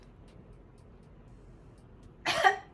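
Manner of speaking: breathy choking coughs. Real, not acted. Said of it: "I'm choking".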